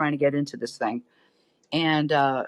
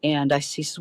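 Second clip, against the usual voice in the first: usual speaking voice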